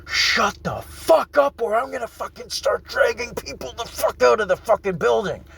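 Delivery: reliving the blow-up at the brawlers; retold screaming. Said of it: "I started screaming".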